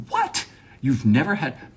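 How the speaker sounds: high-pitched acted disbelief; mock incredulity. Reacting to coworker never having Pepsi, mock incredulity. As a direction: shoot up high in mock astonishment.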